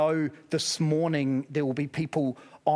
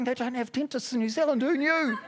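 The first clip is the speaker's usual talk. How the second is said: higher, quicker gossiping whisper-aside voice; mock snooty churchgoers; 5 semitones higher than usual. Imagined Hollywood churchgoers mocking him, higher and quick. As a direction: tease like smug beautiful people nudging each other. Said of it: "they're nudging each other going".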